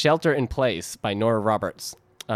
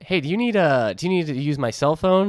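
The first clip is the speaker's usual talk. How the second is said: helpful stranger; 6 semitones higher, 3 dB louder than usual. Retold or imitated. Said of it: "somebody's like".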